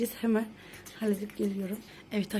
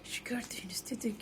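whispering; 10 dB quieter than usual. Real, not acted. Whispering to viewers near sleeper.

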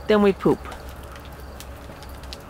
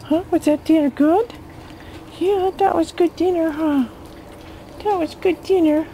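talking to a dog; 9 semitones higher than usual. Real, not acted. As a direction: coo at the dog, pitched up. Baby-talking the dog after dinner.

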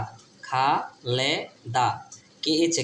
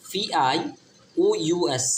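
ordinary talk

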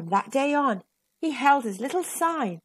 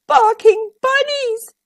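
moaning cry; 12 semitones higher, 6 dB louder than usual. A narrator's reading. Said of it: "he moaned".

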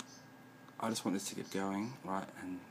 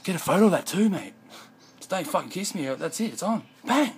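much higher, much louder wrestler taunt; wrestler trash talk; 10 semitones higher, 8 dB louder than usual. Retold or imitated. Squaring up to a guy in his face, wrestler taunt. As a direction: bark it face to face like a pro wrestler. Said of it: "like a wrestling poster".

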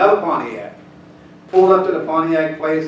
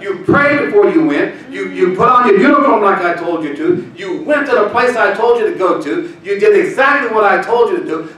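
preaching louder and higher as God speaks; rising preaching; 4 semitones higher, 4 dB louder than usual. Acted. Preacher voicing God's blessing to the congregation, building loud.

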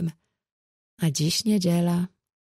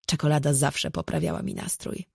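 ordinary delivery, nothing marked

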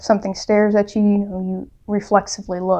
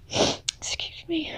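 slow, higher whisper while recovering from tears; choked whisper; 8 semitones higher, 13 dB quieter, much slower than usual. Real, not acted. To viewers after crying, choked whisper. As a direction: apologize under your breath, still choked up.

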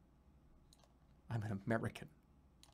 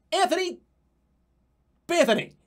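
much higher and louder, like an announcer calling a name; showy high announcer call; mock candidate announcement; 8 dB louder than usual. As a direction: belt out the made-up candidate's name.

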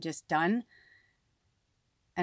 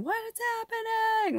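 imitated movie scream. Likening herself to the Poltergeist daughter, high imitated scream. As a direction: shriek it like a horror-film daughter, without full volume. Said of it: "she's, like, screaming".

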